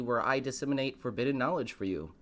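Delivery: ordinary delivery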